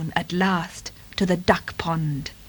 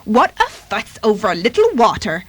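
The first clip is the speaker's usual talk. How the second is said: dismissive quacking duck voice; put-on duck, unimpressed; Dorothy Duck; 4 dB louder than usual. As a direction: shrug it off like a duck. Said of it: "quacked Dorothy Duck, shrugging her wings".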